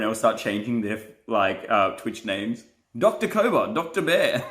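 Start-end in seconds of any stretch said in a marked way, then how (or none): 3.0-4.4 high, giggly delivery; cracking up while talking; laughing. Real, not acted. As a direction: say the names through laughter, pitched up. Cracking up at invented nicknames.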